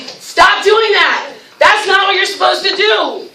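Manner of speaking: high, drawn-out scolding shout; mock scolding. Shown as a demonstration. Teacher throws criticism at the volunteer, mock scolding.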